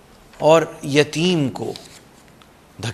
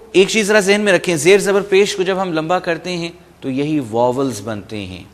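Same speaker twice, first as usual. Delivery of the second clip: ordinary talk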